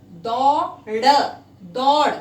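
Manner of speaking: "दौड़" called out slowly with a retroflex ṛ; loud slow demo. Shown as a demonstration. Teacher calling out "दौड़" for the class. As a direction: draw out "दौड़" loudly. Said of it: "ये शब्द है".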